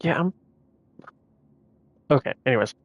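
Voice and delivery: ordinary talk, nothing marked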